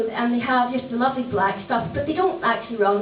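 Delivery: ordinary talk, nothing marked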